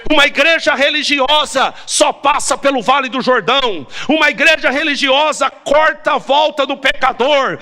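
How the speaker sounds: high-pitched, driving pulpit delivery; impassioned sermon cry; fervent preaching. Real, not acted. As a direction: preach it hard, stretching the key phrases. Preaches at full force.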